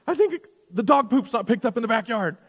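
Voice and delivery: high, loud mock panic about a surprise guest; hammed-up freak-out, pitch jumping up. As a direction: squeal it like the house is a disaster. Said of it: "we freak out".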